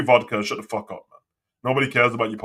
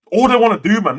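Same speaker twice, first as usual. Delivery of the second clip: frustrated outburst; 9 semitones higher, 9 dB louder than usual. Real, not acted. Angry rant at podcasts.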